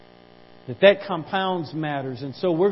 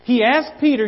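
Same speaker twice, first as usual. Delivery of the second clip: higher, louder preacher's cry, slowed at first; full-force preaching; 6 semitones higher, 5 dB louder than usual. Acted. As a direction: preach it out, building.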